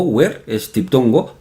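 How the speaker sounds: usual speaking voice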